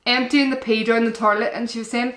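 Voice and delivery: ordinary talk, nothing marked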